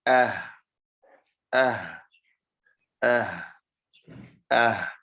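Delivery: Ah said with a clear glottal stop then a breathy h, hamza with sukun ha; pron demo. Shown as a demonstration. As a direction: model Ah slowly, both letters audible. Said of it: "Do you feel the two letters?".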